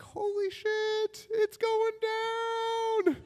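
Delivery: tiny slow falsetto squeal; soft, very high, stretched-out glee; secret mock thrill. Teen hearing of condoms, tiny squealed thrill.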